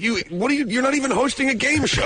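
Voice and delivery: high-pitched, incredulous mock protest; mock indignation. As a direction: protest as if deeply insulted, playing it for laughs. Host relays his indignant protest.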